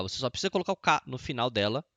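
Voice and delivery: usual speaking voice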